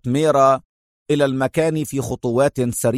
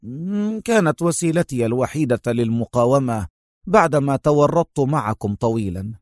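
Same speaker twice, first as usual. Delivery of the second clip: ordinary delivery, nothing marked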